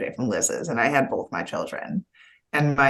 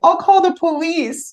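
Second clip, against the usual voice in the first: very high, louder mock-tearful child voice; crying son; 5 dB louder than usual. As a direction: threaten like a tearful little boy. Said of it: "he started crying again".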